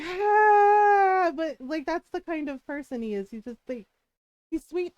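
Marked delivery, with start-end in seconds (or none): 0.0-1.3 loud high cry of frustration; frustrated shout. Retold or imitated. Giving up on her stubborn partner, loud frustrated cry. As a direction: let out a loud exasperated cry. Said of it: "So I was just like".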